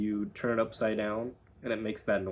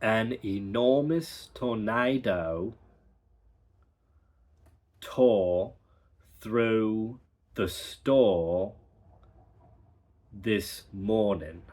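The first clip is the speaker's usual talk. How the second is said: slow, R-less reading; careful dialect practice; accent drill sentence; slower than usual. Shown as a demonstration. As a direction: stretch the vowels, drop the R's. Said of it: "So the practice sentence is".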